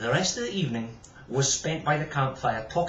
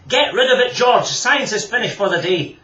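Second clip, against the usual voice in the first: mock stern; 9 semitones higher, 9 dB louder than usual. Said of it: "trying to act a little bit more suspiciously".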